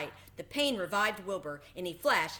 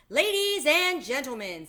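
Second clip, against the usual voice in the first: higher, louder fair announcer; loudspeaker announcer; 8 semitones higher, 9 dB louder than usual. A narrator's reading. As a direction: open the ceremony for the crowd. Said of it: "said the loudspeaker".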